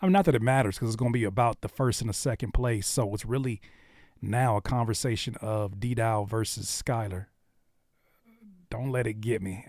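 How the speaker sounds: ordinary delivery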